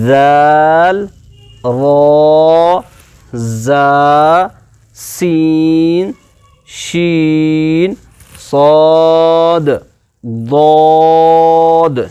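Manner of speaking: teacher chants the lesson's letters slowly; slow letter recitation. Shown as a demonstration. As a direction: stretch out every letter name for the class. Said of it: "آج کا سبق".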